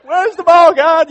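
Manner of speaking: high, loud bewildered cry; a mock-bewildered kid on the field asking God where the ball is; lost and confused. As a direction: call out, totally lost. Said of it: "most of us do look like this".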